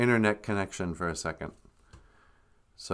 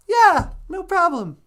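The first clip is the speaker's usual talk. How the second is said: grandma voice; 5 dB louder than usual. Acted. High grandmother voice on the call.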